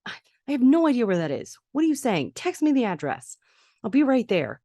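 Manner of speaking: high-pitched, urgent retold questions; urgent phone call. Mother on the phone to Amber about the address, urgent. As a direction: rattle it out high, like a worried parent.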